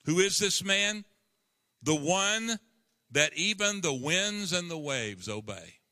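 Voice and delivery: high, loud, slow closing crescendo; preaching at full force. Acted. Preacher closing the sermon to his church, loud and high. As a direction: build to the final proclamation.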